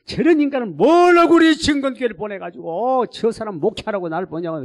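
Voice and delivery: loud, resentful outburst; furious complaint about the deacon; angry. Retold or imitated. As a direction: spit it out in anger. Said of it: "얼마나 미운지".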